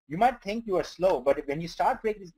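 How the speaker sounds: normal talking voice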